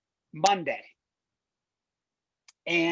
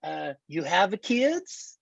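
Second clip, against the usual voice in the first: put-on drawn-out Borat accent sales pitch; 2 semitones higher, slower than usual. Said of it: "do the entire demo in Borat voice".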